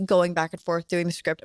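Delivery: ordinary talk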